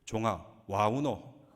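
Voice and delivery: slow, drawn-out Gyeongsang dialect with a laugh. Retold or imitated. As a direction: stretch it out like a terse Gyeongsang man, chuckling. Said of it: "경상도 사나이는 뭐라 그런지 압니까?".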